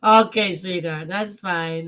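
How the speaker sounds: usual speaking voice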